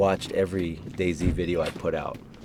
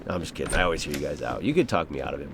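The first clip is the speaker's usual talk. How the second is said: normal talking voice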